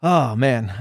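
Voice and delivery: usual speaking voice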